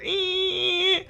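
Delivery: high-pitched sustained "eee" whine; imitated piercing noise. Imitating the noise that comes back, high thin whine. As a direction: mimic a soft, piercing ringing tone. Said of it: "the noise comes back".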